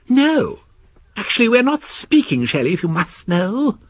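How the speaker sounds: high, huffy reply; E W telling Shelley they are not speaking, huffy; lightly sulky worm. Acted. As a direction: answer stiffly, still sulking. Worm sulking.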